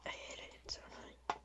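hushed whisper. Real, not acted. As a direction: whisper it under your breath. Whispers through the recording.

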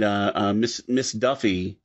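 ordinary talk, nothing marked

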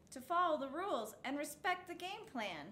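plain reading voice

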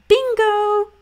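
high, loud triumphant bingo; excited cheer. A narrator's reading. Sister cheering her brother's right answer, high and loud. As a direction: cheer like a proud big sister. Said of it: "said Judy".

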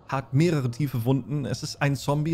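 ordinary talk, nothing marked

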